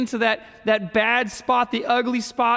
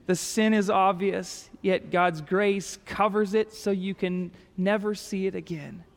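ordinary delivery

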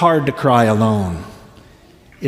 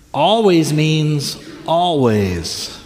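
ALWAYS drawn out slowly both times; emphatic stress; much slower than usual. Real, not acted. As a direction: stretch each "always" slowly to drive it home. Preacher drives home a promise.

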